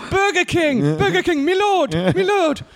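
high, grand proclamation of a burger monarch; a hammed-up knight rallying the troops for war; mock royal herald. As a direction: cry it out like a medieval herald, hammed-up. Mock king's herald and war cry.

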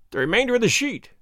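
high-pitched exclamation of discovery; inspector's cry of recognition; astonished cry. A narrator's reading. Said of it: "cried the inspector".

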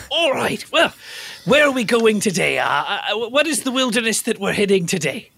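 put-on Bear Grylls, British accent. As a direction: ask like a British survival host. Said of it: "I just heard your accent".

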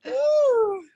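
high whooping laugh into a squealed ooh; screaming laugh. Real, not acted. Celebrating staying for the whole season, screaming laugh. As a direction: shriek with joy.

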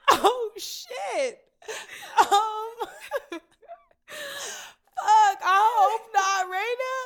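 loud, high real wail; laughing through tears. Real, not acted. Thinking of parents crying alone, wailing through laughter. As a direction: cry it out, overwhelmed, laughing at yourself. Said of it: "it just kills me thinking about them crying".

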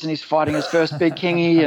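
ordinary talk, nothing marked

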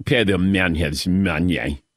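low, slow, bad Russian accent; mock a horribly overdone Russian accent; Teddy KGB's horrible accent. Host shows how horrible the movie accent is, mock Russian accent. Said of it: "that's a horrible accent".

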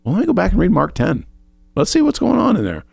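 high, quick, mock-eager inner voice; chirpy can-do thought, said to yourself; bright little pep talk before reading; eager self-talk. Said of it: "So I'm like".

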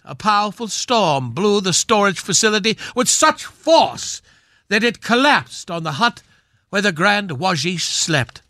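ordinary delivery

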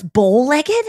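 "bow-legged" with an L after bow; "bowl" for "bow". Retold or imitated. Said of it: "I thought it was like a bowl".

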